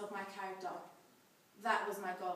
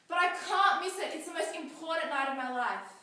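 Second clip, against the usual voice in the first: raised, higher voice, pleading back at full volume; loud desperate protest; 7 semitones higher, 10 dB louder than usual. Acted. Teenage daughter begging mum to allow grad night, loud protest.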